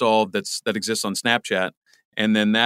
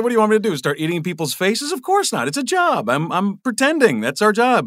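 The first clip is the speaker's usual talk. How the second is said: mock-exasperated actor, pitched much higher and louder; hammed-up Anthony Hopkins; 10 semitones higher, 3 dB louder than usual. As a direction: play a famous actor scoffing at method acting, going way up. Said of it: "He's like".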